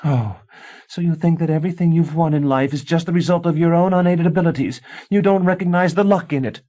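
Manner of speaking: high, loud scornful challenge; mock his pride; scornful wife. A narrator's reading. A wife scorning her husband's pride, high and loud.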